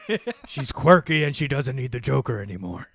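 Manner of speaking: loud, laughing through the quote; laughing put-on voice. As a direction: chuckle through the words. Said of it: "And he's like".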